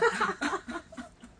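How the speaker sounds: high laugh. Real, not acted. Ending the story with a high laugh.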